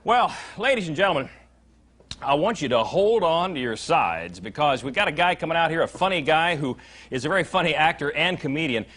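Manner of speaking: loud, high showman's build-up; big emcee announcement; announcer intro. Acted. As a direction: hype the crowd for the next act. Host introduces the comic.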